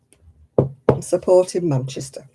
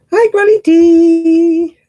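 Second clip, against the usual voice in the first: high, loud, slow hello; drawn-out loud cheery hello; excited greeting; 11 semitones higher, 10 dB louder, much slower than usual. Real, not acted. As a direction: call out warmly and loud to a friend. Greeting a viewer by name.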